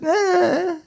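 high, silly little dismissal; blithe young pilot. Acted. Young pilot admitting he can't fly, blithe. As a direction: toss it off in a goofy high voice.

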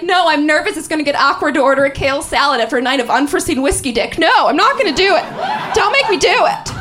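fast, fretful mock protest; mock passive-aggressive whining. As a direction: whine it quickly, building to a high plea. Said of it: "are we so passive-aggressive".

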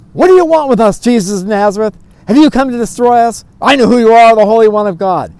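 loud, high-pitched challenge thrown at Jesus; possessed man's outcry. Acted. Possessed man confronts Jesus in the synagogue, loud outcry.

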